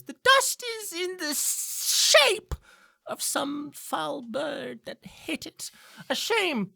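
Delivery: high, slow, odd professor's voice; old professor voice. Acted. Professor inspecting the window for the student, high and slow. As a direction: muse over the window like a fussy old teacher. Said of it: "she points at it and she goes".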